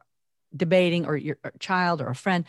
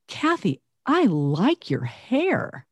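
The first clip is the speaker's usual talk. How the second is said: "I like your hair" drawn out slowly after a high "Kathy"; high "Kathy", then a slow, deliberate "I LIKE your hair"; different emphasis; 4 semitones higher, much slower than usual. Shown as a demonstration. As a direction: stretch out "like your hair" as a pointed compliment. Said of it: "just a total different emphasis".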